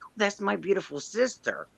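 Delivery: high, soft adoring sister; gentle high-pitched gush; retold fondness. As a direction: imitate a proud, sweet sister. Sister's fond answer, retold.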